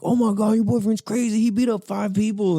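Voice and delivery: high, amazed classmate voice; excited gossip. Retold or imitated. Classmate telling the ex a wild rumor, high and excited. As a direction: gush out a shocking rumor.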